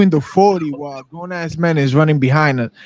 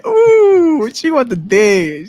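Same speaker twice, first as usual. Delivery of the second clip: very high, loud ooh; excited ooh; 7 semitones higher, 3 dB louder, slower than usual. Retold or imitated. Friends tease about a girl's hair flip, high loud ooh. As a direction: hoot at her move.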